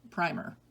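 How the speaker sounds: ordinary talk, nothing marked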